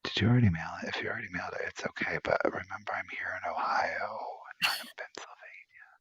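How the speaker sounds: breathy hushed aside; whispered aside. Real, not acted. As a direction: whisper it all. Speaks in a whisper.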